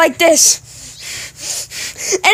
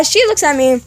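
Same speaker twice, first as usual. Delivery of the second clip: ordinary delivery, nothing marked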